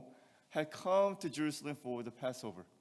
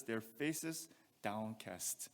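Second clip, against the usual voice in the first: "Their faces downcast" slow and softer, sinking at the end; slow and heavy; 6 semitones lower, 8 dB quieter, much slower than usual. Shown as a demonstration. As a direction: let "downcast" sink slowly. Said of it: "look at what he says".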